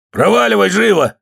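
high, forceful order; acted official's command; shouted dismissal. A narrator's reading. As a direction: drive her out.

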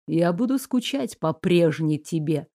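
low, soft sad voice; quiet sorrow. A narrator's reading. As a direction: say goodbye gently. Raoul sad farewell.

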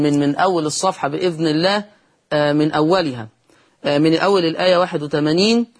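usual speaking voice

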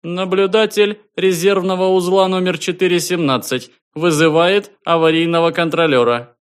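high, loud official announcement; a distant station calling out formally; formal observer voice. A narrator's reading. Voice of the space observer.

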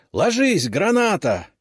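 high shouted warning; grenade warning. Acted. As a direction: yell the warning to take cover. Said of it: "кричу".

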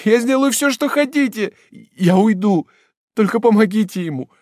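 very high and loud weeping plea; tearful begging. Acted. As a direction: implore the men through tears. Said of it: "молила несчастная, заливаясь слезами".

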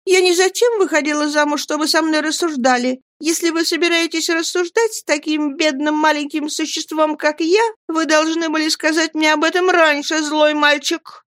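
put-on high, aggrieved childish voice; pouting young wife. A narrator's reading. Dora reproaches her reasoning husband, high pout. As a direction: reproach him in a high whine.

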